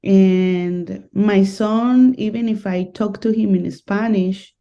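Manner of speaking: ordinary delivery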